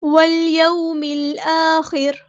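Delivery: slow, drawn-out chanted recitation; tajweed chant; Quranic recitation. Shown as a demonstration. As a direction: recite it melodically, stretching each sound. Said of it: "recites Quran verse in tajweed".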